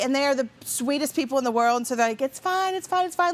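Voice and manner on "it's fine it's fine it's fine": lightly voiced kind older man, higher; sweet host saying it's fine to the apologizing mom, lightly voiced; reassuring host. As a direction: brush it off sweetly, higher pitched.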